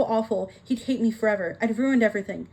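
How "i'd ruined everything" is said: ordinary delivery, nothing marked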